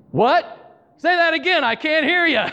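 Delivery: put-on old man shouting into a phone. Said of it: "talking loudly".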